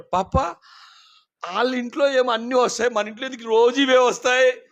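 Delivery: lightly voiced toddler wondering; child's question. Two-and-a-half-year-old son asking his father, childlike question. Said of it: "రెండున్నర సంవత్సరం వయసులో".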